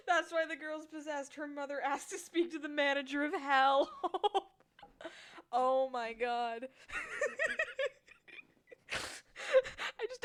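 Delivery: talking through laughter; laughing. Real, not acted. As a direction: laugh while saying it.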